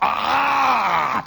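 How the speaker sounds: loud high mock scream of a corn ear. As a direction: shriek like corn ripped off the stalk. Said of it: "those corn ears scream".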